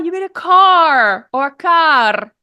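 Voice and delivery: each "car" drawn out with a hard final R; exaggerated rhotic "car". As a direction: mimic students stretching "car" and sounding its R. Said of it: "disassociation between spelling and accents and pronunciation".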